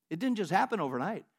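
usual speaking voice

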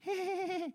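high, soft giggly squeal; worked-up squeal. Acted. Preacher imitates people who couldn't contain themselves, giddy high squeal. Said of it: "we'd be so worked up".

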